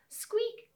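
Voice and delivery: very high tiny squeak; a quick high mouse squeak. Acted. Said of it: "said the mouse".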